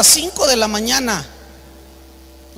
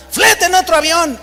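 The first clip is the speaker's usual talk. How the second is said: higher-pitched, imperious mock order; acted bossy order; 6 semitones higher than usual. Preacher ordering the clerk to find a plane, acted bossy indignation. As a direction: imitate a passenger ordering staff about.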